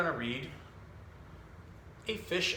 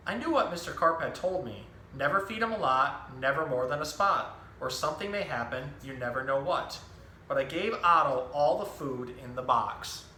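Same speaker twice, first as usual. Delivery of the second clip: lively picture-book storytelling voice, each line clearly paced; reading to children; 3 semitones higher than usual. Acted. Zookeeper reading a book to children, animated storytelling. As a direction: tell the story to a room of young kids.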